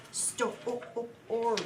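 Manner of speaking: story stretched out sound by sound, Sto- o- o- ory. Shown as a demonstration. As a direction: drag out the o sound in story for a child. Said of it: "look for the word story".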